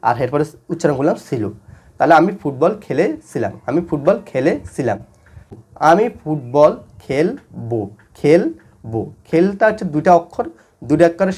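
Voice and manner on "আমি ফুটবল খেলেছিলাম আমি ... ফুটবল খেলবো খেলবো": slow, with gaps between words; slow example reading. Shown as a demonstration. Teacher reading tense examples to students, slow and word by word. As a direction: recite each word separately for learners. Recites example sentences slowly.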